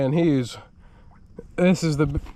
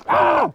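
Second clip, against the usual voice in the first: sudden sharp yelp, much higher; a jolt of surprise as the rod bends; startled high yelp. Real, not acted. Fish yanks the rod, startled high yelp.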